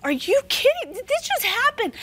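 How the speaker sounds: high, breathless disbelief; retelling the moment her husband died, high and stunned. Retold or imitated. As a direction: say it like the floor fell away. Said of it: "And I was like".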